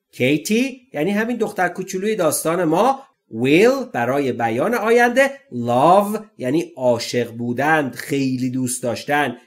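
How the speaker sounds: usual speaking voice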